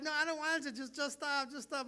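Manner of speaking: high, teary-sounding plea; mock pleading girlfriend. Retelling the girlfriend's refusal, mock crying plea. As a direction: beg her to stop, half crying. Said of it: "she was all like".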